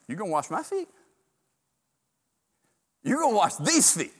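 can't believe the Lord would do this; incredulous Peter. Acted.

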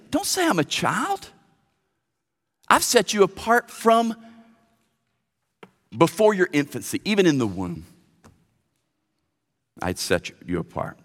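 high, then softening to a hush; God reassuring. Acted. God reassures young Jeremiah, starting high and ending hushed. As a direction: correct him, then reassure gently. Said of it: "What does God say".

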